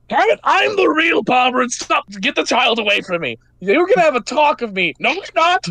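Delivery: high-pitched protest against an impostor; indignant Barberin. Acted. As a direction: insist you are the real one, voice jumping up. Real Barberin argues with impostor.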